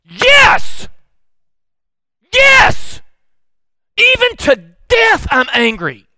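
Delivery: high shouted yes, yes, then a slow, defiant roar; furious shout. Acted. Jonah shouting his anger back at God, high and loud. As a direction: hurl it back at God at full volume. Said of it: "It was more like this".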